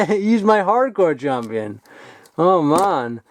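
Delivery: ordinary delivery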